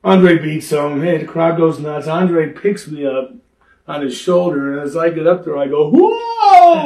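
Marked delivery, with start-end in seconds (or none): 5.9-6.8 high, loud, falling whoa as if slipping; fake startled cry. Wrestler fakes a fall from Andre's shoulders, loud high yelp. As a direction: yelp like you're really losing your balance.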